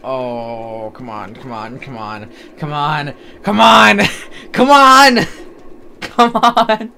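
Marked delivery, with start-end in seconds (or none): 2.6-6.9 climbing to a high loud laughing yell; frantic, laughing pleas; frantic shouting. Real, not acted. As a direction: player frantically yelling at a jump, laughing. Frantic at a tough jump.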